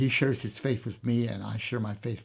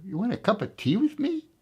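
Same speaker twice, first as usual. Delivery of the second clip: pitched much higher in disbelief; incredulous; 10 semitones higher than usual. Retold or imitated. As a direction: react like the offer is absurd. Commander can't believe it.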